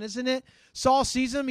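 normal talking voice

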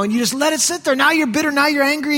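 ordinary delivery

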